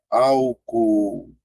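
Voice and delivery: "álcool" very slow, broken into drawn-out syllables; syllables separated. Shown as a demonstration. Teacher demonstrating syllable division, "álcool" slowly segmented. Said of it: "quando você for separar".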